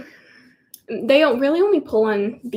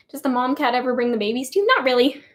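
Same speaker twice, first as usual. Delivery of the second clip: ordinary delivery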